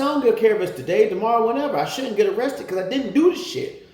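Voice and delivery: fast, high, loud shout; furious defiance. Retold or imitated. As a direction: yell that you don't care. Defiant at officer reassurance.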